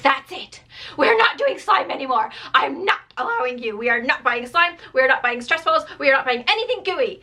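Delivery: put-on a mom losing it at her kid over slime; mock meltdown rant. Mom snapping at her kid over the slime mess, exasperated. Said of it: "and I said, like".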